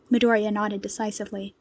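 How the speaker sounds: usual speaking voice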